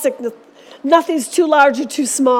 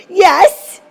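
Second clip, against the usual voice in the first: put-on grumpy little girl; 5 semitones higher, 8 dB louder than usual. Hungry grumpy girl answers mom.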